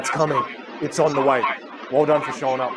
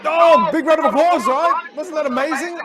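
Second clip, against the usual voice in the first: very high, excited applause call; thrilled cheer; 14 semitones higher, 6 dB louder than usual. Real, not acted. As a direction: lift the voice high and celebrate. Calling for applause, thrilled by clip.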